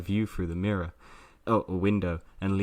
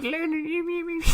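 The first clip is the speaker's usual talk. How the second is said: high falsetto. Pretend. Mocking a sung intro.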